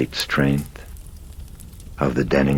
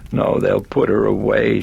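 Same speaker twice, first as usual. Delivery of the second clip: high, loud sobbing plea; 8 semitones higher, 6 dB louder than usual. A narrator's reading. Chris sobs to the priest that they'll take her daughter away, high. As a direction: sob it out, desperate. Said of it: "she was sobbing".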